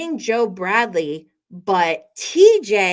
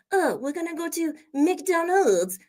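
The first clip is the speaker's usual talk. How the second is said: put-on higher-pitched French-accented English; mock French accent; 7 semitones higher than usual. French person heading to McDonald's, in a mock French accent. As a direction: imitate a French speaker, MCDONALD'S included. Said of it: "we don't expect you to say McDonald's".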